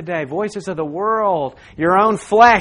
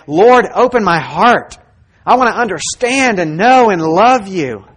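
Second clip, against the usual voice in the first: fervent prayer; 4 semitones higher, 10 dB louder than usual. Shown as a demonstration. Preacher models a prayer for the congregation, fervent and loud. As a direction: cry out the prayer with full conviction.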